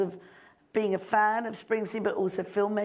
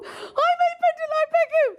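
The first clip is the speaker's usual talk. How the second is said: much higher and louder shrieked boast; overexcited blurt; 6 dB louder than usual. Retold or imitated. Director pitching herself to Bruce, shrieked. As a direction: squeal your credits at your idol. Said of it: "It was really not cool at all".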